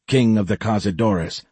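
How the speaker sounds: usual speaking voice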